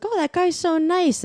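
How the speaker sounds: high-pitched admiring park friend; mock fond praise; imitated gushing friend.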